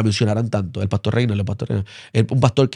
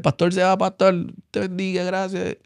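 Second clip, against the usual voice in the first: higher, softer voice of the prisoners saying goodbye; imitated inmates' farewell; 7 semitones higher, 5 dB quieter than usual. Prisoners blessing the pastor on his way out, lightly voiced, higher and softer.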